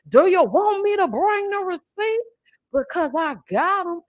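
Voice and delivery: high, drawn-out diva challenge. Retold or imitated. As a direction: ham up a haughty dare. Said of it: "it was very theatrical every time she would speak".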